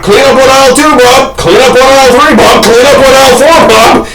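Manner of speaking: high, repetitive cleanup call; store announcer. Retold or imitated. Imitates store intercom announcement.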